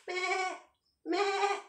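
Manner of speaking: high bleating meh; goat bleat. Shown as a demonstration. Teacher bleats like a goat for the class, high.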